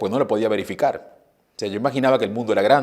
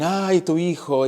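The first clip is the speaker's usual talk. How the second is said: slow, drawn-out admiring sigh; a lightly voiced neighbour gushing; 8 semitones higher, much slower than usual. Retelling how the neighbours gush to his mother, drawn out. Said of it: "hoy le dicen".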